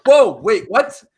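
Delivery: high, loud, startled reaction; retold startled shock. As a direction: act out being caught off guard. Retold shock at being caught.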